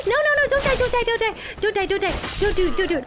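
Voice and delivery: high, loud repeated begging; rapid-fire panic, easing slightly; panicked pleading. Real, not acted. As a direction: plead frantically for it not to happen. Panicking as game death nears.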